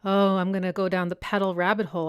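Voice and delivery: ordinary delivery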